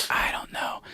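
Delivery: unsure, voiceless whisper; whispered doubt. Retold or imitated. Listener doubting his wild claims, imitated whisper. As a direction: whisper it as if privately baffled.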